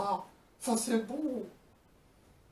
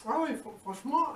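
normal talking voice

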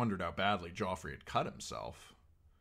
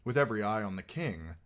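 usual speaking voice

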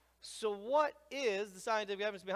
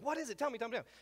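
eager; 7 semitones higher, much faster than usual. Retold or imitated. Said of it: "I was like".

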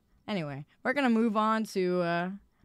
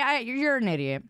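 ordinary talk, nothing marked

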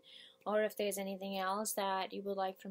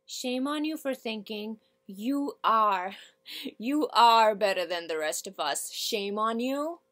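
rising from restraint into open reproach; monologue character, indignant; 11 dB louder than usual. Acted. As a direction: scold them with full conviction.

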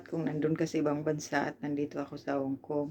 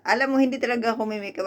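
mock excuse; 6 semitones higher, 4 dB louder, faster than usual. Mocks relative's excuse.

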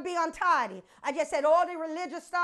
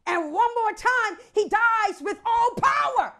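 higher, louder, slowing on the climax; forceful preaching; 5 semitones higher, 5 dB louder than usual. Real, not acted. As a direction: drive the triumph home to the church.

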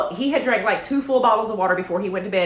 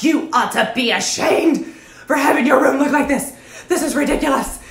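angry fussing; 3 semitones higher than usual. Acted. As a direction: lay into the kid in a re-enacted telling-off. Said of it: "I mean, I'm, I'm fussing".

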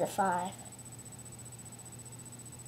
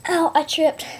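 mock pain; 4 semitones higher, 7 dB louder than usual. Trips while picking flowers.

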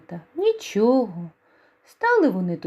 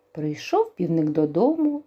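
ordinary delivery

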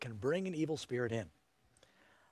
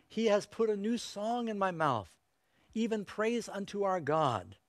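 scripture quote; 5 semitones higher, 3 dB louder, slower than usual. Acted. Quotes a psalm.

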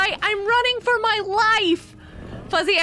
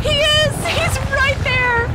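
scared horror game streamer; 6 semitones higher, slower than usual. Real, not acted. Warden still there.

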